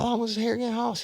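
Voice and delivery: very high, folksy. Retold or imitated. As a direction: lead him on slyly. Partner voiced high.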